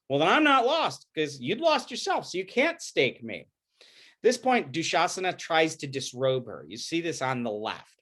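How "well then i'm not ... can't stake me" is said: higher, sharp defiant comeback; defiant retort. Acted. Draupadi rebuking her husband who staked her, defiant. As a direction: throw his own logic back at him.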